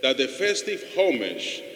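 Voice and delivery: slow, measured collect prayed aloud; formal liturgical prayer. Real, not acted. Priest praying to the Lord for the consecrated people, formal prayer.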